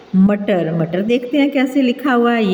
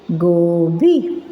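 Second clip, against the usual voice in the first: गोभी stretched very slowly, syllable by syllable; drawn-out word; 3 semitones lower, much slower than usual. Shown as a demonstration. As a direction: draw out गोभी for beginners. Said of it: "गोभी में गाफ वाओ गो". (गोभी को बहुत धीरे खींचकर)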